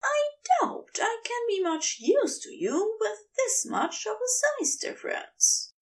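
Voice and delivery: a timid, high-voiced angel admitting doubt; Aziraphale voice. A narrator's reading.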